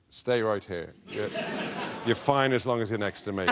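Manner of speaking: low, lightly put-on rock star voice; a hint of a calm, low reassurer, badly imitated. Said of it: "That's the worst [name] impression ever".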